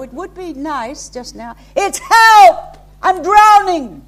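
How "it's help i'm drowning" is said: shouted high and loud, frantic; desperate cry for help. Pretend. Preacher acting out a drowning man's frantic shout. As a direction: yell like someone drowning. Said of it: "drowning person".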